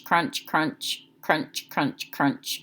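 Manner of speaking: quiet chewing-noise crunch; soft crunching. A narrator's reading. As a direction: murmur each crunch softly. Narrator imitates chewing sounds.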